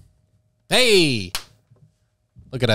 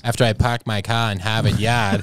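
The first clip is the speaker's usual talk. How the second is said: r-less "Park my cah in Hahvahd Yahd"; Boston accent; 9 semitones lower than usual. Pretend. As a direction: drop every r, Boston style. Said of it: "That was good".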